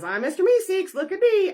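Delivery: high, loud cartoon character voice; Mister Meeseeks. Character announcing himself when summoned, high cartoon voice. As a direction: burst out in a loud, high-pitched squeal of introduction. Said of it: "you call Mister Meeseeks and he says".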